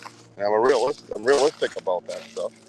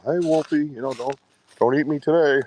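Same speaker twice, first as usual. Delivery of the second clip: higher, pleading voice to a wolf; mock pleading; 7 semitones higher than usual. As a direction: beg it playfully, half joking.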